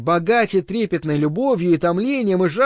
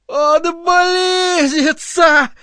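much higher, slow ecstatic yell; 12 semitones higher, 4 dB louder, much slower than usual. Acted. Jim yells to the circle in frenzy. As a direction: howl it out in frenzy. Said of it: "завопил Джим Бакановский".

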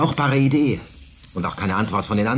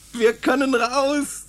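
put-on boy realising they can escape, overjoyed shout; overjoyed cry; slower than usual. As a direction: cry out the freedom you just found. Discovering escape route.

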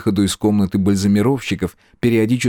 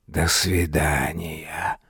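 slow, low, soft farewell; hushed, drawn-out mournful farewell; sorrowful ghost; 5 semitones lower, 6 dB quieter, much slower than usual. A narrator's reading. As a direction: bid goodbye sadly, like a fading shade. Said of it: "печально изрекла тень".

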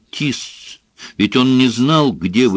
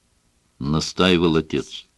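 ordinary delivery

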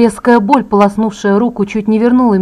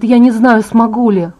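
usual speaking voice

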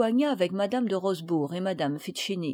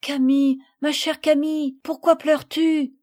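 higher, louder anxious question; worried; 5 semitones higher, 5 dB louder than usual. A narrator's reading. Marguerite asks her crying friend why, worried. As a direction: ask with urgent concern. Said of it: "lui demanda Marguerite avec inquiétude".

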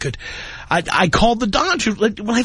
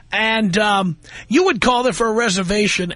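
ordinary talk

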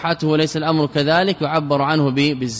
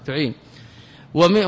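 ordinary talk, nothing marked

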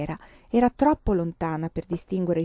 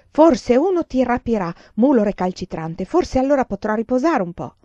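louder, higher exasperated scold; scolding servant; 5 semitones higher, 6 dB louder than usual. Acted. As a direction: snap at the stubborn girl. (serva esasperata, più forte)

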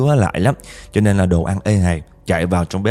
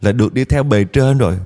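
high, pleading ghostly lament, much higher; crying spirit; 6 semitones higher than usual. Retold or imitated. Aunt Bảy's spirit pleading to relatives, high and tearful. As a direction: wail as a trapped suffering spirit. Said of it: "dì Bảy còn khóc á".

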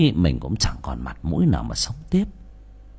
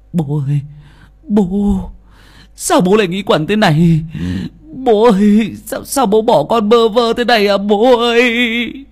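wailing lament; 12 semitones higher, 7 dB louder than usual. Acted. Son kneeling by his dead father's body, loud wailing lament. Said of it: "gào lên / tiếng khóc thê lương".

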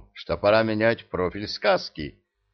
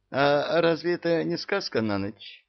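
mildly surprised question, stress on разве; lightly voiced puzzled girl; 8 semitones higher than usual. A narrator's reading. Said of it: "удивилась Жасмин". (удивлённо, с ударением на «разве»)

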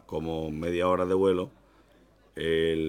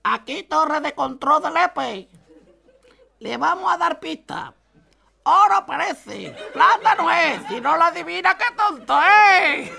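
very high, slow, laughing riddle voice; sing-song it like a daft village operator; silly squeaky tower voice; 11 dB louder, slower than usual. Acted. Lepe tower answering the plane, very high and silly.

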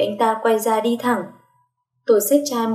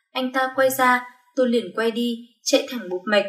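usual speaking voice

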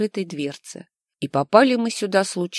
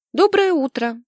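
high, bright chirpy greeting; chirped; 10 semitones higher, 4 dB louder than usual. Acted. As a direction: chirp it sweetly, much higher. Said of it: "прощебетала я".

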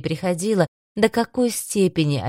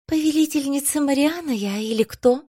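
much higher, playful and bossy; bossy little princess; 9 semitones higher than usual. A narrator's reading. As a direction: demand it like a spoiled princess.

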